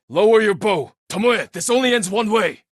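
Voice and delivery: loud stern command; commanding shout. Acted. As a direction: force her to stand down. Ordering rival archer at bowpoint.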